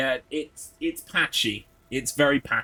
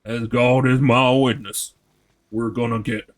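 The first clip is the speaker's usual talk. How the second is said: put-on southern accent; 4 dB louder than usual. Streamer vowing to finish the game, in a mock-solemn southern accent. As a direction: swear it grandly in a southern American accent. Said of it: "that's some good southern accent".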